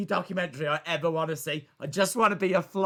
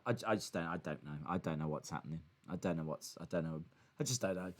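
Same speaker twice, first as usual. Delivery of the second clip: much lower and softer, mumbling to himself; bewildered mumble; 13 semitones lower, 13 dB quieter, faster than usual. Real, not acted. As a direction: trail off in disbelief under your breath.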